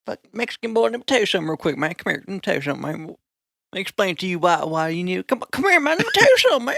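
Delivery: hammed-up exasperated cowboy, spluttering; mad cowboy. Cowboy explaining something to a newcomer, mock mad. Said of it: "they get mad".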